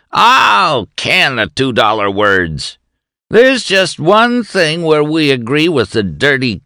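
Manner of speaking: shouting. A narrator's reading. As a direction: hammer the sneering words home. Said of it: "McGoblin shouted".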